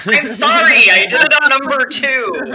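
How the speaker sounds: laughing loudly through the apology. Real, not acted. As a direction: giggle through an embarrassed confession. Laughing confession.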